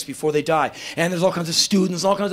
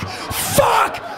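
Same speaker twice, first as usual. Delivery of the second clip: a big high yell of amazement; yelled curse. Retold or imitated. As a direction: let the curse fly out loud and high. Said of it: "not the thing to yell as loud as you can".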